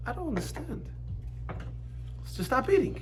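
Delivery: retold question, high and soft; gently baffled, lifted pitch, no scolding; innocent puzzlement. Asking his overweight brother about eating, innocently puzzled. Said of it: "I didn't mean it in a condescending way".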